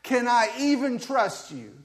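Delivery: loud, high, impassioned lament; prophet's complaint to God. Acted. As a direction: rail at God as the doubting prophet. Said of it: "he's basically saying".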